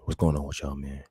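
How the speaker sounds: deep smooth radio-lover voice sending good wishes. Pretend.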